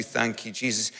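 a soft murmured thanks; whisper-soft thanks in prayer. Shown as a demonstration. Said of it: "It might just be".

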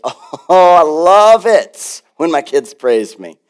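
slow, drawn-out relish; hammed-up fatherly delight; delighted daddy. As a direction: savour it like a doting father, stretching the words. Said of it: "he's a good daddy that says".

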